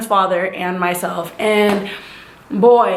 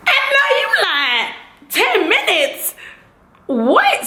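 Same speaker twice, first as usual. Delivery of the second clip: shocked disbelief; 9 semitones higher, slower than usual. Real, not acted. Hearing the baby comes in ten minutes, high shocked yelp. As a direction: yelp it like you can't believe it. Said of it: "disbelief at ten minutes".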